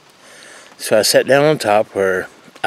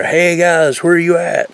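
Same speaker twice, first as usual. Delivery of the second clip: higher mock holler across the mountain; louder retold yell; 6 semitones higher, slower than usual. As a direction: call out louder to the missing guys. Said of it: "So I yelled even louder".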